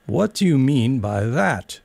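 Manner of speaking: slow, stern demand. A narrator's reading. Caterpillar questioning Alice, stern and slow. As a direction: press her for an answer. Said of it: "said the caterpillar sternly".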